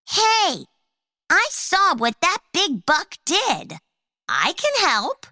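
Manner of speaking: high little ant voice; tiny ant voice. A narrator's reading. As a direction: squeak it like a tiny brave ant.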